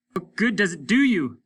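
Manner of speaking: high, loud desperate cry; cried out. A narrator's reading. Hollis cries out to Lesper in despair, loud. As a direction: hurl the question at him. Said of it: "he cried to Lesper".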